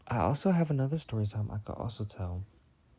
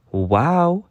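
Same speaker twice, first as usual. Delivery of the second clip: stunned wow; 8 semitones higher, 9 dB louder than usual. Retold or imitated. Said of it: "I'm like".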